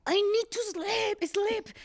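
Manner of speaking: high, loud, slow whine; cranky kid. Retold or imitated. Mom imitating her tired son, high whiny and slow. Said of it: "Y voltea".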